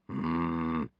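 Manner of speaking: low, soft pondering hum; long, quiet musing sound; Stoic, thoughtful. A narrator's reading. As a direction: hum low as you think it over.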